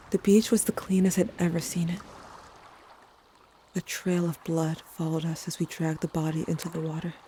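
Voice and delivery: ordinary delivery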